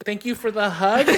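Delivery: high, loud awkward thank-you. Retold or imitated. Son thanking dad after the hug, high and awkward. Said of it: "And I'm just like".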